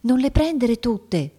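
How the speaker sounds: high, drawn-out schoolgirl voice; slow, urgent aside in a small high voice; murmured warning. A narrator's reading. Girl warning her deskmate about the caramels, murmured warning. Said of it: "mormorò una vicina".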